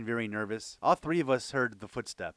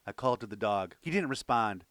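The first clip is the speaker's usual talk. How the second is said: usual speaking voice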